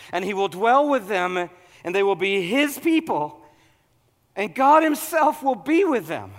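loud, high, impassioned sermon; preaching at full force. Acted. Pastor urging the congregation at full force, preaching. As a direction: preach it hard to the crowd.

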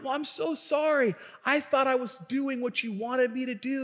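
put-on high, timid kid apology; a kid nervously apologizing to the neighbor; apologetic kid. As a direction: say sorry like a caught nine-year-old.

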